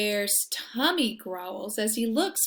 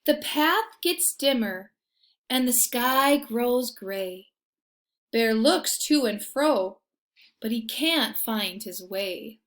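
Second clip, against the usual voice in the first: lively storybook reading; reading to children; 7 dB louder than usual. A narrator's reading. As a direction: read it like a bedtime picture book. Reading picture book aloud.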